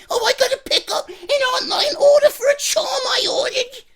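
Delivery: very high mocking whiny customer voice; high-pitched mock customer whine. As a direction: squeak it out like a silly customer.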